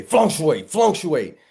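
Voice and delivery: Fluctuate punched high and loud, twice; loud falling "fluctuate" said twice; emphatic repeated word. Shown as a demonstration. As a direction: hammer the word fluctuate home. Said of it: "That's why you heard me say".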